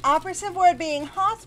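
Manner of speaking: playful high emphasis; teasing. Pretend. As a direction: tease her sweetly. Teasing compliment.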